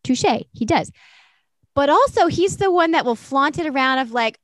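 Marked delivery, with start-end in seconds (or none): none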